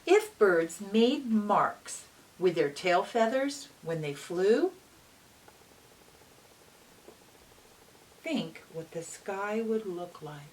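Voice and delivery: bright, lively read-aloud voice; reading to children. A narrator's reading. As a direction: read it like storytime for little kids. Reads picture book aloud.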